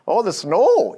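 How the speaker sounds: imitating a Montana relative's Nordic accent, lightly. Lecturer imitates his father's Montana family, light Nordic accent. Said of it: "they have a very Nordic, uh, sort of accent".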